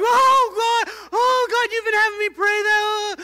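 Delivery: high, loud, tearful wail; crying out to God. Retold or imitated. Preacher retelling his Friday prayer, high tearful wail. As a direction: cry it out like the preacher did that Friday. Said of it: "I'm sobbing like a little crybaby".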